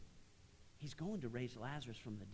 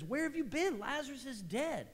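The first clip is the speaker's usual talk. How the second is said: much higher, louder, a grieving reproach; anguished cry; 12 semitones higher, 5 dB louder than usual. Acted. Martha meets Jesus late after her brother died, anguished and high. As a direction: hurl the question at him in grief.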